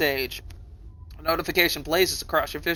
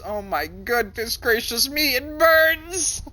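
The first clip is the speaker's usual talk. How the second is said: high, rising to a loud squeal on burns; mock pained exclaim; 7 semitones higher, 4 dB louder, slower than usual. Player reacts to the burning power, hammed-up prissy squeal. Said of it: "My, my response is".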